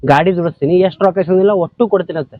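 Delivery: exasperated pleading. Acted. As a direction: plead for time, rising into reproach. Pleads and protests in debt quarrel. (ಬೇಸರದ ಮನವಿ)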